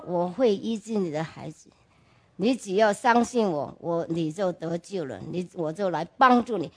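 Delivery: tender and intimate, like a secret; hushed promise. Retold or imitated. God's promise, quoted softly again. (非常轻柔、近乎耳语地说出应许)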